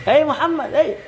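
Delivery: calling out. Shown as a demonstration.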